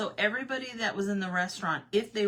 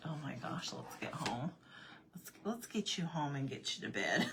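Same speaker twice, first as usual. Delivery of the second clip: soft, low exasperated mumble; quiet, resigned coaxing; retold weary husband; 3 semitones lower, 8 dB quieter than usual. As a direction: imitate a patient husband under his breath.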